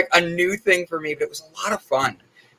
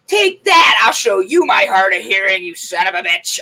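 mock triumphant shout; 2 semitones higher, 4 dB louder than usual. Mom teasing her son, shouting in mock triumph. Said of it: "my mom's like".

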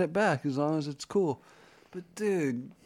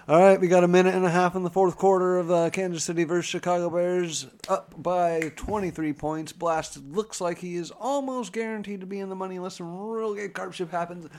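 fast, loud play-by-play call; mock sportscaster; 4 semitones higher, 3 dB louder than usual. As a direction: call it like a hyped TV announcer. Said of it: "Talking like a sportscaster".

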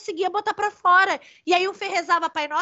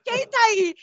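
imitated rude demand; 5 semitones higher, slower than usual. Woman imitating her friend challenging a spirit, rude. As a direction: mimic a man rudely confronting someone. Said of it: "acho que fui grosseiro".

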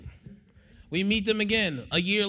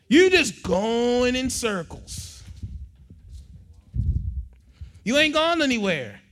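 loud, high preaching voice; 8 semitones higher, 6 dB louder than usual. Acted. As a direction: preach it out to the crowd. Preaching at full force.

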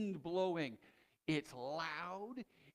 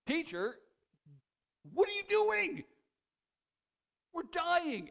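panicked shout; 12 semitones higher, 9 dB louder than usual. Retold or imitated. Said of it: "He's like".

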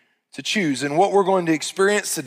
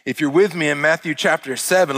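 usual speaking voice

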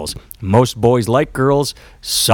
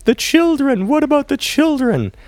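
very high, pleading mock churchy wail; shrill mock-pious cry for the kids; Maud Flanders. Mocking the moms' outrage in a high pleading voice. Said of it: "the thing the one million moms always bring up is Maud Flanders".

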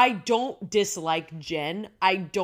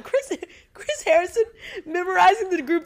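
incredulous; 10 semitones higher, 6 dB louder than usual. Real, not acted. Mocking idea of old host.

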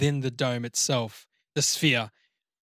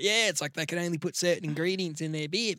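Kiwi impression; 3 semitones higher, 4 dB quieter than usual. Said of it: "does he sound like that?".

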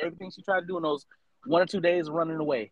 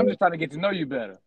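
mock seductive; faster than usual. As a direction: play it like a smooth talker. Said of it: "I put my sexy voice on too".

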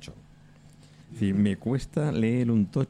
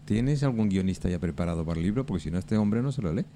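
usual speaking voice

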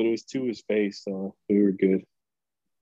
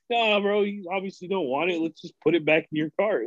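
higher-pitched imitation of stepping in; mock peacemaking; 7 semitones higher than usual. Coworker breaks up shoppers' fight, mock placating. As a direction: break up the squabble in a raised pitch.

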